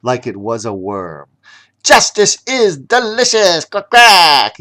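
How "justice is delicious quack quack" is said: high, loud duck gloating; smug duck. Acted. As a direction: savor the victory like a duck. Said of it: "Ya-ya added".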